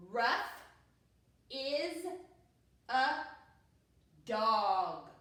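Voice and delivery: loud, separated words with a pause after each; word-by-word reading. Shown as a demonstration. Teacher reading the sentence for new readers, word by word. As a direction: read each word firmly on its own, spaced out. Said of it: "pull it all together and read our sentence".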